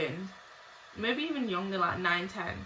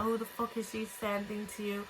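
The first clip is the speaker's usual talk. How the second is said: ordinary delivery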